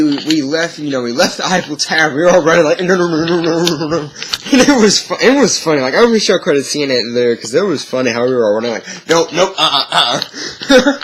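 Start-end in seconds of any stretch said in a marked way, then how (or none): 2.8-4.1 flee in a hammed-up panic; mock terror while running away; panicked running. All running from the attack. 9.1-11.0 half-laughing mock retreat; laughing refusal. Running away, laughing at it.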